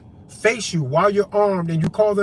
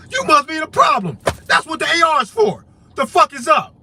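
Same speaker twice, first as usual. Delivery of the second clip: higher, louder street challenge; much higher and louder mock confrontation; confrontational challenge; 8 semitones higher, 6 dB louder than usual. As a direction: square up and demand what's up.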